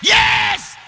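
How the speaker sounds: high, loud preaching building to shouts; full-force preaching. Acted. Preacher telling the church they are restored, shouted preaching. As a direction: drive it up to a roaring, stretched climax.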